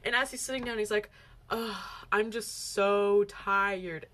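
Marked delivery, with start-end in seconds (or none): 1.5-4.1 slow, drawn-out mock weariness; mocking mimicry. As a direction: make fun of their excuse, dragging out the words. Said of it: "making fun of us with it".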